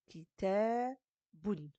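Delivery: short "ki", long held "ta", then "boun"; long middle vowel. Shown as a demonstration. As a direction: stretch "ta" and finish on "boun". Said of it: "elle a une longue voyelle".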